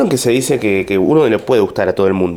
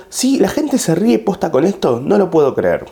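much higher, disbelieving guy online; pitched-up imitation of an outraged verified user; incredulous commenter; 8 semitones higher than usual. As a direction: sound baffled that people laugh. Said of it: "que puso".

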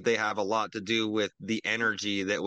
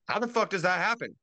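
outraged repeat; 6 semitones higher than usual. Real, not acted. Ranting podcaster repeats his question, outraged disbelief. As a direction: hit "fuck" hard, higher than the first time.

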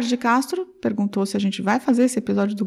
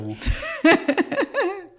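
burst of laughter; 10 semitones higher than usual. Real, not acted. Laughs at the name.

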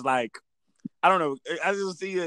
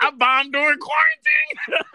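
mocking quote; 4 dB louder, slower than usual. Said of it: "She was like".

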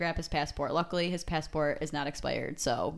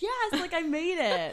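much higher, celebratory cheer; triumphant; 10 semitones higher, slower than usual. Retold or imitated. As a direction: cheer like you just made it through. Crossing the border triumphantly.

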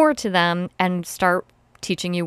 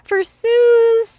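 high squeal, louder; squealy high voice; 8 dB louder than usual. Real, not acted. Squealed dedication.

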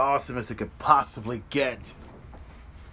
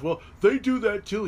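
higher-pitched mock protest; objecting wrestling fan; 5 semitones higher than usual. Modern day wrestling fan objecting, mock protest. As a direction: pipe up as a quibbling fan. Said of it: "some modern day wrestling fans".

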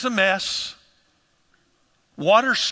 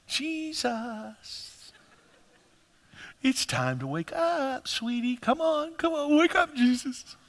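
soft, gently mocking wake-up, a lot higher; mock sweet wake-up call; 6 semitones higher, 5 dB quieter, slower than usual. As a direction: coo him awake like a sleepy child. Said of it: "they did not go to him and say".

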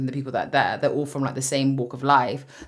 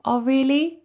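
mimicked colleague; 9 semitones higher, slower than usual. Retelling colleague's pleased reply.

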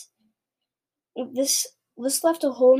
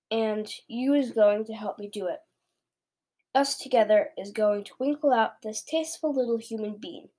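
ordinary delivery

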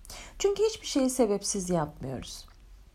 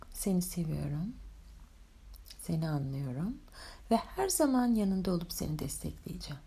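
soft spa whisper; 6 dB quieter than usual. Acted. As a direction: guide them gently into calm. Leads a guided meditation.

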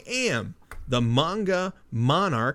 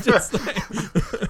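laughing; 6 semitones higher than usual. Real, not acted. Laughing at the bomb scene.

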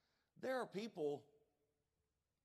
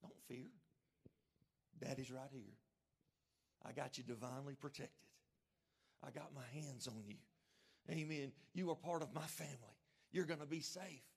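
soft, hushed fatherly reassurance; comforting father; 4 semitones lower, 5 dB quieter than usual. Acted. Heavenly Father calming his frightened child, soft and tender. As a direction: hold them close with your voice. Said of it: "a heavenly Father that says".

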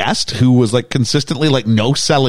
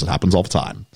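low, soft unbothered waiter line; deadpan butler; 8 semitones lower, 5 dB quieter, faster than usual. Retold or imitated. Waiter calmly tells the girl it happens all the time. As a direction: shrug it off as a calm waiter, low and quiet. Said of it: "And he goes".

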